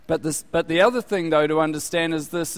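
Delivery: normal talking voice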